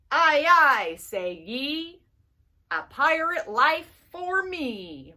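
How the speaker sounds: lightly voiced pirate, punchy start; a hint of a hearty pirate; mild pirate sing-song. Pirate rhyme in book.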